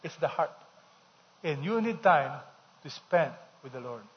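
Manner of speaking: ordinary delivery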